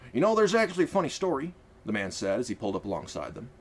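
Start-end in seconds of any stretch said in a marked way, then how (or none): 0.1-1.5 a hint of a folksy older driver, pitched up; lightly voiced easygoing man. Marcus the carriage driver.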